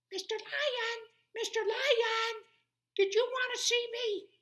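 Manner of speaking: very high, loud squeak; mouse voice. Acted. Tiny mouse agreeing to see the lion, squeaky.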